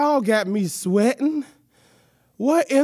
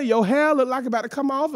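ordinary talk, nothing marked

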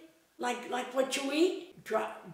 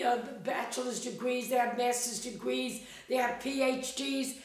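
normal talking voice

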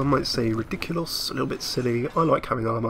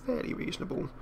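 much higher, softer echo; 11 semitones higher, 8 dB quieter than usual. Real, not acted. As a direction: echo the phrase up high and soft. Repeats phrase in a different voice.

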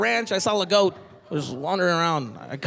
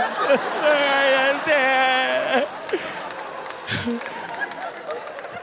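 drawn-out breathy laugh; long high cackle; 6 semitones higher than usual. Real, not acted. Comic laughing at his own story. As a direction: lose it laughing.

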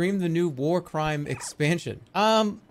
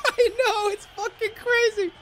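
laughing. Real, not acted. Laughing at own rant.

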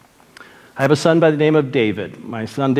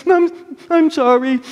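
high-pitched tearful mock sobbing; imitated crying; 13 semitones higher than usual. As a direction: act out a crying son apologizing. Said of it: "He's crying".

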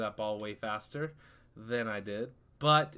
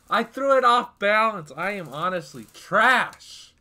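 frustrated outburst; 7 semitones higher, 12 dB louder, slower than usual. Real, not acted. After the blunder.

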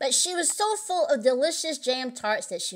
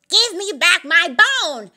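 loud, high, drawn-out demand; demanding cry; 6 semitones higher, 6 dB louder than usual. A narrator's reading. Old Mother Hubbard catching the knave, loud demanding cry. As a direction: cry out for it back. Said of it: "cried Old Mother Hubbard".

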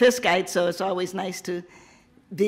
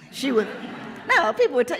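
laughing; 6 semitones higher, 3 dB louder than usual. Real, not acted. Laughing while telling.

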